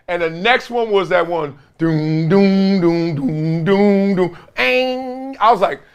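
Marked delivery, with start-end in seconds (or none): 1.8-4.4 rhythmic mouth beat; mock bass beat from the song; imitating a beat. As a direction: thump out the bass line.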